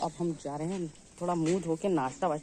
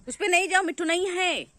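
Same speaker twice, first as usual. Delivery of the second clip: loud, high-pitched call; calling out warning; 12 semitones higher, 8 dB louder than usual. Real, not acted. Owner tells pet the parrot is gone, loud high call.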